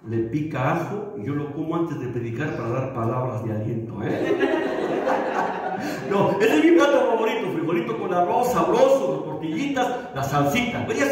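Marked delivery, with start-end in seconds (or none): 4.4-6.0 a short airy chuckle at his own joke; breathy laugh. Real, not acted. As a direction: laugh it off lightly, almost silent.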